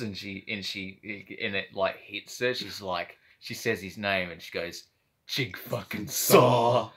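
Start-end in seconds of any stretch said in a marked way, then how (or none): 5.2-6.9 slow, drawn-out mock line reading; imitating the actress. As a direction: say it slowly like a stiff actress. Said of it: "but she said it like that".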